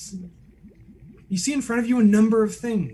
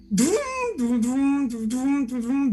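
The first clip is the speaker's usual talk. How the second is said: rising mechanical vroom sound effect; whirring winch noise; 6 semitones higher, slower than usual. Acted. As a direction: make the machine whir as he drops. Mimicking winch sound as man drops.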